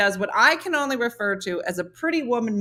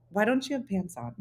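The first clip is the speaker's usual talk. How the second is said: imitating a softly puzzled technician; hesitant technician; 7 dB quieter than usual. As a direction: ask it quietly, carefully, as an awkward question. Technician's puzzled question, first telling.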